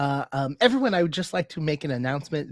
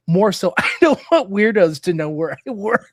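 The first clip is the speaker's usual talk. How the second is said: loud, laughing retort; 5 semitones higher, 8 dB louder than usual. Retold or imitated. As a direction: laugh through the punchline. Retold own reply, laughing.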